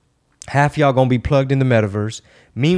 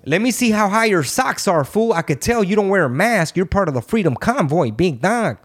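higher-pitched Chicano homie, teasing the viewers; 6 semitones higher than usual. Acted. Host mocking anti-mask viewers in a homie voice, higher and teasing. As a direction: play a street homie calling people big dog, pitched up. Same homie persona continues.

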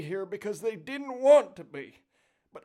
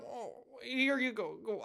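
normal talking voice

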